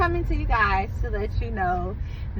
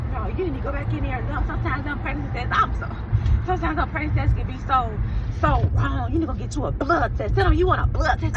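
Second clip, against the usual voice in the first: higher, rapid-fire advice; rushed, higher voice of a sister; imitated sister; 7 semitones higher, faster than usual. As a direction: play an insistent sister, quick and high.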